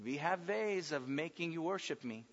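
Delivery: put-on soft German-accented torturer; menacing movie interrogator with a German accent. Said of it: "that old German accent".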